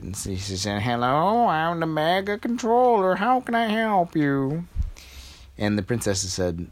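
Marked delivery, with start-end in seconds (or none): 0.8-4.7 put-on old woman. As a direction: greet them like a sweet old lady. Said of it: "an old woman answered".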